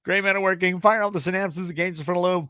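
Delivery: high, sing-out announcer build; game show host building to the cue; hyped segment intro. Acted. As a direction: announce it high, stretching the last words.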